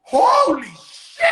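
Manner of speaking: loud, slow shocked gasp; stunned. Retold or imitated. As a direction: let the shock land slowly. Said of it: "I'm like".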